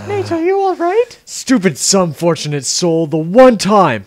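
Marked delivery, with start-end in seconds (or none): 0.1-1.2 high-pitched woman's voice; high female voice. Acted. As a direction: play her high and light. Elena voiced.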